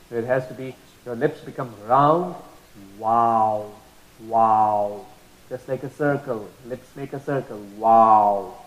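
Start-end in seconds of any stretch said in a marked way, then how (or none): 3.0-5.0 wow said with lips rounded, no teeth. Shown as a demonstration. Teacher demonstrating the Arabic waw sound. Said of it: "That's how we say it, through the lips". 7.8-8.6 wow said with lips rounded, no teeth. Shown as a demonstration. Teacher demonstrating the Arabic waw sound. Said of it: "That's how we say it".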